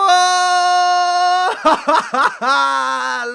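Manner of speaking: loud, high whoop into laughter; long exultant cackling laugh; triumphant laugh. Real, not acted. Gamer celebrates Lukaku's goal, triumphant laughter.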